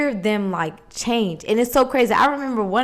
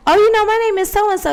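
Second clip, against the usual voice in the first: mock girl; 9 semitones higher than usual. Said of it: "this girl".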